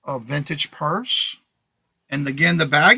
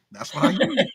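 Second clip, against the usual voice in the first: laughing; 8 semitones higher than usual. Real, not acted. Laughing about old hair.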